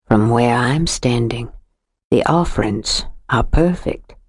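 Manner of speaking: low, lingering put-on Southern drawl; syrupy drawl. A narrator's reading. Stranger hints at menace to Jo, low exaggerated drawl. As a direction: savor anticipation, sweet and sinister. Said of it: "It was like syrup, too sweet, too thick".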